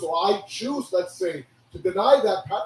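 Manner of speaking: usual speaking voice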